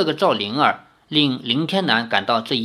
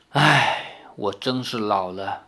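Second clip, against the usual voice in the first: lower, much softer, sighing; weary sigh; 6 semitones lower, 4 dB quieter, slower than usual. Acted. Lin Tiannan admits defeat, sighing lower and much softer. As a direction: let the words sink with age and defeat. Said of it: "叹道".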